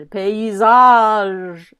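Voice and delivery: paysage with a long, loud final -age; stress on last syllable. Shown as a demonstration. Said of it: "from that tonic stress".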